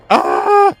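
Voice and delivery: very high, loud shriek; sudden piercing yelp; startled scream. Real, not acted. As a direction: scream as the enemy gets you. Caught by enemy in game.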